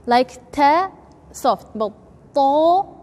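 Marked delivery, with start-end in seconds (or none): 2.3-3.0 "po" strong with tongue between gum and teeth; emphatic po. Shown as a demonstration. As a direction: hit "po" hard and full. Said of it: "is strong".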